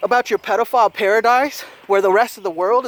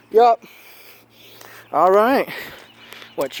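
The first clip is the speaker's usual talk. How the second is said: ordinary delivery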